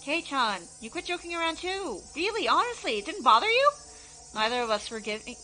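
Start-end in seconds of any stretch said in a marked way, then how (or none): 0.0-3.7 rising, pleading and louder; insistent girl voice. Acted. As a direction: press him, unbelieving. Shion insisting loudly.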